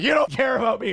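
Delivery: high, loud imitated accusation; mock upset outburst. April accusing him before leaving for the bathroom, high mock yell. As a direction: throw out a hammed-up hurt complaint.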